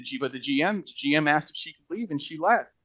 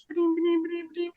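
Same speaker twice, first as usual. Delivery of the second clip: high chirpy machine chime; ping it out like a little printer; sticker machine chime; faster than usual. Retold or imitated. Imitating the sticker printer for the listener, high chime. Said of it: "it makes this little sound".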